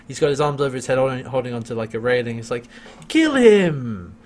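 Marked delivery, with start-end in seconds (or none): 3.1-4.1 loud, drawn-out goading; hammed-up villainous command; imitated villain urging. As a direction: egg them on, stretching the words.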